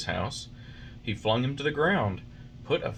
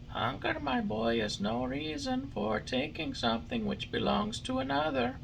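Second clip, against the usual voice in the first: high, softer scolding glowworm; 4 dB quieter than usual. A narrator's reading. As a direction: gently reproach the puppet.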